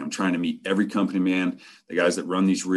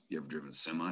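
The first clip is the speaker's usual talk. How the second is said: softer, slightly deep boss voice; deep bass voice; 3 semitones lower, 13 dB quieter, much faster than usual. Retold or imitated. Boss interviewing outside the shop, lightly deep voice. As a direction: lightly imitate a big man, low-key. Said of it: "in his deep-ass voice".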